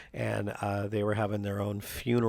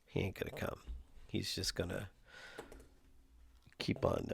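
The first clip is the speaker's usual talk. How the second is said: quiet aside; 3 semitones lower, 10 dB quieter than usual. Real, not acted. Aside after failed calling.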